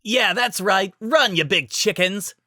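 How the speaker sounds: loud, cocky jeering; taunting. A narrator's reading. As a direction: jeer at fleeing foes. Rainbow Dash taunting.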